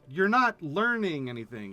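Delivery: ordinary talk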